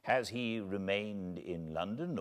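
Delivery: usual speaking voice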